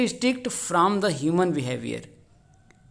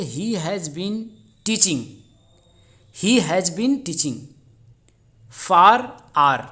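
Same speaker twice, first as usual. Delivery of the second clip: word by word, very slow reading; slow dictation; much slower than usual. Shown as a demonstration. Teacher reading the exam question to students, very slow dictation. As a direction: dictate it so students can write.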